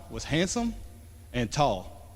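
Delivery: ordinary talk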